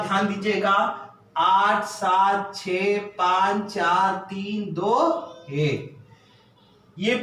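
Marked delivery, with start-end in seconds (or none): 1.4-6.0 slow counting. Shown as a demonstration. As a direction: count each number slowly and clearly. Counting positions aloud for class. (धीरे-धीरे गिनती)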